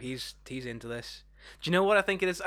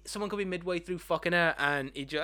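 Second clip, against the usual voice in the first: ordinary talk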